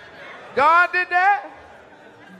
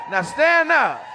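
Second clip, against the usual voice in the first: shouted order; 9 semitones higher, much slower than usual. Real, not acted. Comic ordering the birthday guest to stand, shouting. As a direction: bark it up at the guest.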